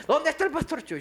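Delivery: high, laughing mock of a pushy member; eager churchgoer. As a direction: tease the crowd chasing the pastor. (feligrés insistente, en burla)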